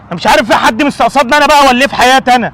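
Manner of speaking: high, loud bitter complaint; loud lament. Acted. Father bemoaning his luck, loud and bitter.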